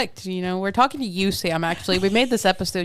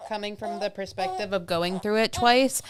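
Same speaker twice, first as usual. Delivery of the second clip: normal talking voice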